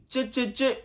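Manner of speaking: high, loud, repeated "tché" sounds; imitated "tché" noise. Shown as a demonstration. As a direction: fire off punchy high-pitched sound effects. Imite un bruit, annoncé par "je fais. (bruitage « tché » aigu et percutant)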